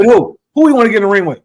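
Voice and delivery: high, loud disbelief; incredulous question. Real, not acted. Host pressing co-host in a boxing debate, loud disbelief. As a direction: demand it in disbelief.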